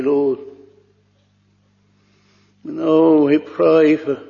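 ordinary talk

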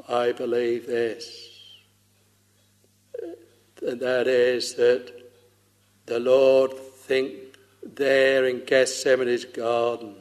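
usual speaking voice

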